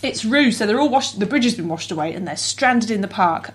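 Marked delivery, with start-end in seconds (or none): none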